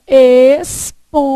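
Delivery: "espontâneo" drawn out, a pause after each syllable. Shown as a demonstration. Teacher shows how to divide it, syllable by syllable. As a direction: split "espontâneo" slowly as es, pon, tâ, neo. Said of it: "Se você fizer".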